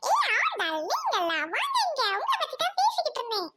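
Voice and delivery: high, quick put-on character voice; excited greeting. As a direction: call her over in a high-pitched rush. Character greets newcomer Aandal. (உச்சக் குரலில் உற்சாக வரவேற்பு)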